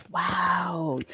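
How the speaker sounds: a breathless whispered wow; hushed, unvoiced wonder; awed whisper. Real, not acted. Reader reacting to a card in a whisper.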